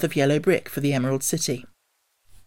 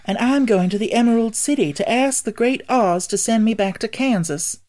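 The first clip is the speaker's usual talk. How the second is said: put-on higher, eager girl's voice; a hopeful little girl explaining; young girl voice; 7 semitones higher, 4 dB louder than usual. A narrator's reading. Dorothy explains her quest.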